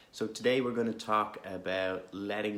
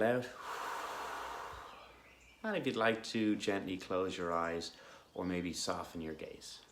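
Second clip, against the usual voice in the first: calm, unhurried guidance with long pauses; guided meditation; 2 semitones lower, 6 dB quieter than usual. Real, not acted. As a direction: gently guide listeners into stillness.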